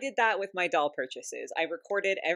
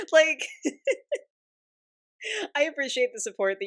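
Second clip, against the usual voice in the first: higher, words mixed with giggles; laughing through words; 9 semitones higher, 4 dB louder than usual. Real, not acted. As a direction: thank them while still giggling. Laughing while thanking.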